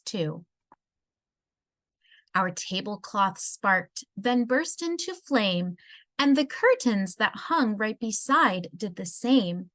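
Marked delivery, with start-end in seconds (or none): none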